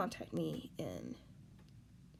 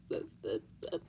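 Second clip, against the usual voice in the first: choked up; 11 semitones lower, 3 dB quieter than usual. Real, not acted. Breaking down over cancelled show.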